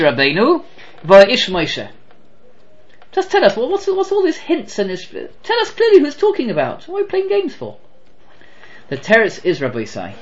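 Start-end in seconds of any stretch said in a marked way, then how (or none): 3.1-7.8 higher, exasperated questioner; impatient challenge. Pretend. Questioner pressing the rabbi, exasperated. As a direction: demand a straight answer, fed up.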